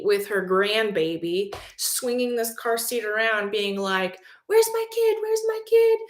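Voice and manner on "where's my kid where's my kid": a high shrill-free much higher demanding yell. Retold or imitated. Mother storming into the school after her kid, yelling. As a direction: shout through the school for your kid. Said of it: "Like, yelling".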